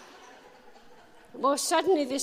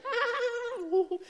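a much higher "Ah" of delight; delighted cry; 13 semitones higher than usual. Retold or imitated. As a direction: officials bursting into holy laughter. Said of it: "they're like".

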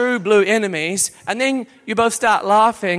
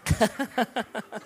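soft giggle; 10 dB quieter than usual. Real, not acted. Laughing in spirit.

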